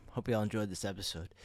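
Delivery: normal talking voice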